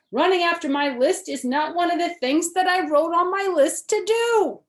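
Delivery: high, loud, whining wail; loud, high fretful wail. A narrator's reading. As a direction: complain at the top of the voice. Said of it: "wailed Toad".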